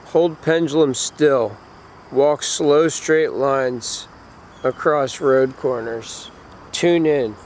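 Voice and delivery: slow, calm instruction read; guided meditation. Acted. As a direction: read each step slowly and evenly. Reads ritual instructions slowly.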